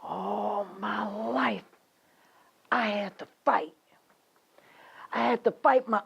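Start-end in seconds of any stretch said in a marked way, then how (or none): 0.0-3.7 grave testimony. Acted. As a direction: start heavy and hushed, stretching the words. Woman recounts lifelong fight.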